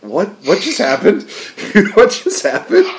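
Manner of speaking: laughing, rising to a high squeal; stunned disbelief. Retold or imitated. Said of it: "where I'm like".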